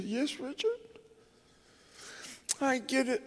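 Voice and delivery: high, quiet voice close to tears; choked up. Real, not acted. Widower preacher answering his bereaved old friend, choked up. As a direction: answer softly through a tightening throat.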